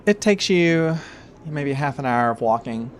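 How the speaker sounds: ordinary talk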